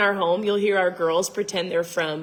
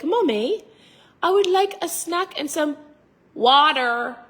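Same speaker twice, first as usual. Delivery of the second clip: put-on higher-pitched little girl with American "water"; mock little kid learning American speech; imitated child's American accent; 7 semitones higher, slower than usual. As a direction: sweetly pipe it up, hard American R in "water". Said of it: "they were learning from their teachers".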